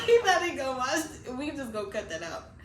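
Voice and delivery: high, loud words through laughter; laughing hard. Real, not acted. Worried the ex-friend will watch, laughing hard. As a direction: squeeze the words out while cracking up. Still cracking up about former friend.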